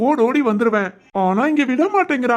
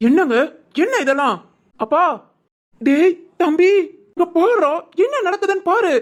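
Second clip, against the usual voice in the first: calling out; 6 semitones higher than usual. Acted. Calling family to see scandal.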